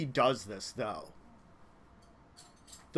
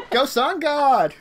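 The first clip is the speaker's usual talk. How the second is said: high, loud, drawn-out cheer; playful rallying shout; mock cheer; 7 semitones higher, 6 dB louder, slower than usual. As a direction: cheer it slowly like a sports fan. Cheers on a god.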